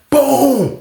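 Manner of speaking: high, falling "boom"; imitated door kick. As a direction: mimic the thud of a kicked cell door. Imitates door being kicked.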